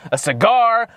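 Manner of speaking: ordinary talk, nothing marked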